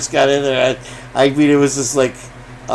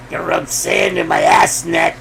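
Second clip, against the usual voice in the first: much lower, put-on jerk voice; jerky voice. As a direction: growl it like an obnoxious jerk. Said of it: "I used to be able to do the jerky voice better".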